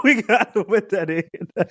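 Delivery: repeated through laughter; giggling the line again; cracking up on the repeat; laughing. Real, not acted.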